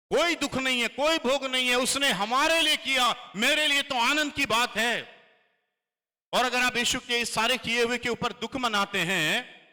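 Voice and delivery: high, loud declaration; fervent preaching. Acted. Pastor preaches to church that the cross is joy, loud. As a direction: proclaim it as joy.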